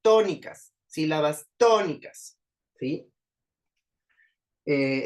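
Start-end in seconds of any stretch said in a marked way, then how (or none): 0.0-0.7 Tónicas, first syllable TÓ punched loud and high; stress on TÓ. Shown as a demonstration. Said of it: "to suena más fuerte que el resto". 1.6-2.4 tónicas with a heavy first syllable TÓ; stress on TÓ. Shown as a demonstration. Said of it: "to suena más fuerte que el resto".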